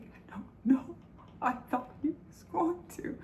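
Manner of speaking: high, thin whimper fading to a whisper; tearful imitation. Crying daughter answering mom's question, imitated tearful whimper. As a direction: act out a helpless, tearful reply.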